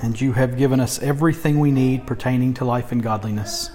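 prayer. Real, not acted. Opening prayer before sermon.

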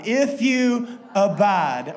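If you abide, with abide leaned on, slow and loud; stress on abide. Shown as a demonstration. Pastor leading the congregation in reading, stressing abide. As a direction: stretch out abide and press it hard. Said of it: "Do it one more time".

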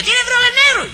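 hammed-up falsetto of a fussy customer; mocking Nananina's voice. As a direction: mimic the customer in a shrill falsetto.

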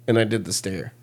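usual speaking voice

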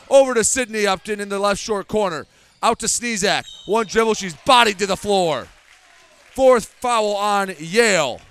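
normal talking voice

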